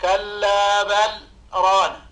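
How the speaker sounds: the lam of بل fully sounded, short stop before ران; clear lam with a pause. Shown as a demonstration. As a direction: keep بل and ران apart with a light silent stop. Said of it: "بالإظهار كما سمعنا".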